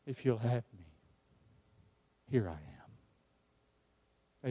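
hushed, slow, low surrender; humble prayer. Acted. Preacher voices a listener's surrender to God, hushed. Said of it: "Have you told God".

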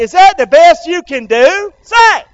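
loud, high pulpit challenge; preacher's challenge. Real, not acted.

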